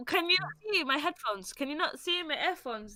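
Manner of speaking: high-pitched mock affronted protest; retold indignant retort. Telling off the oversharing shopper, high indignant retort. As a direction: play up being put out, pitched high. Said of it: "And I'm like".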